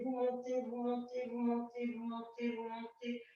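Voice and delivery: soft repeated cue, fading steadily; soft guiding count. Real, not acted. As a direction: guide the lift in an even, dwindling murmur. Coach guides a gradual rolling lift.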